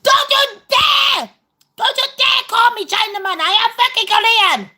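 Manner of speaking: hammed-up indignant shouting; mock outraged shout. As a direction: bellow it as an insulted Korean man. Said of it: "he'd be like".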